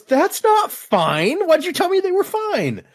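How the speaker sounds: high, slow indignant cry; mock outrage. Dalinar protesting to Havar, high and indignant. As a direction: squeal it in disbelief. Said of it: "And Downer goes".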